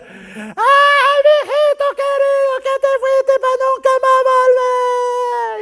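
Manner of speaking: very high, slow, drawn-out mock lament; hammed-up funeral wailing. Preacher imitating a bereaved mourner at a wake, exaggerated high wailing. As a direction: wail it out like a theatrical mourner. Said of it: "daban grandes alaridos".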